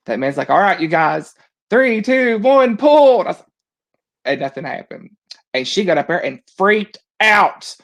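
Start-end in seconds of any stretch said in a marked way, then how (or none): 0.5-3.2 loud, raised countdown call; bullhorn holler. Retold or imitated. Ride worker to the riders hanging up top, hollered countdown. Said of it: "that guy hollers on a bullhorn".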